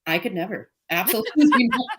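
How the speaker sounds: laughing flat no way; emphatic refusal. Retold or imitated. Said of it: "just being like".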